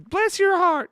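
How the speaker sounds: hammed-up Southern lady with Bless your heart; Southern accent. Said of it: "some people that have accents".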